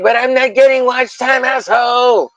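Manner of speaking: high, whiny put-on complaint; whiny Peter. Peter complaining about watch time, high and whiny. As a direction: gripe like a sulking kid. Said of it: "He's like".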